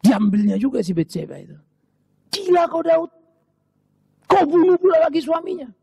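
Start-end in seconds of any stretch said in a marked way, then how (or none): 2.3-5.7 higher, loud accusing cry; shouted rebuke from the prophet Nathan; prophet's rebuke. Acted. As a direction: thunder at the king like an outraged prophet. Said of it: "Nabi Nathan berteriak padanya".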